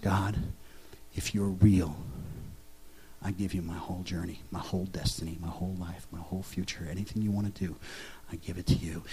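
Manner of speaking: barely above a breath, giving it all up; a hushed retelling of the prayer on his bedroom floor. Retold or imitated. Crying out a surrender prayer.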